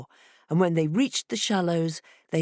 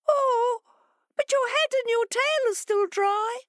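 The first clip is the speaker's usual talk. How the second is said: much higher, sly fish voice; fish calling back. A narrator's reading. Fish answering the complaining fox, high and sly. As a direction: chirp it back innocently. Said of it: "the fish called back".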